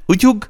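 quick, high "اوتیوگ" with a soft ty; cutesy soft pronunciation. Shown as a demonstration. Teacher repeating the cutesy word for learners, high-pitched. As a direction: say "اوتیوگ" high, tongue softened babyishly. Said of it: "باید لوس کنین زبونتون رو".

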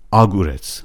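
آگورتس with the weight on opening آ; stress on first half. Shown as a demonstration. Teacher showing a hypothetical wrong stress, آگورتس stressed up front. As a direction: hit the first syllable of آگورتس. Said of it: "فشارش روی نیمهٔ اول کلمه باشه".

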